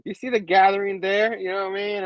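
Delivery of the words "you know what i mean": ordinary delivery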